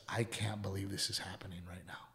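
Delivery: softly whispered, low and breathless with awe; almost whisper it, overcome; hushed retelling. Retold or imitated. Retelling grieving man's words, hushed.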